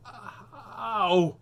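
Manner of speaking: rising wail of "ow"; pained yelp. Pretend. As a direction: yelp as hair is pulled. Hair being pulled.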